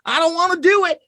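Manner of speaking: high, fast, whining plea in a put-on voice; whiny mock bomber. Mocking a man with a bomb in his lap, high whiny plea. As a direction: plead like a scared, hammed-up captive.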